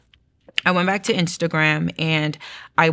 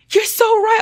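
high, gushing retold relief; elated; 5 dB louder than usual. Telling her sister she's so right, elated. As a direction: gush in a much higher, louder voice.

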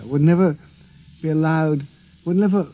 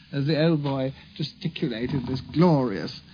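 ordinary delivery

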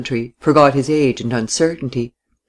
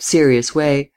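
usual speaking voice

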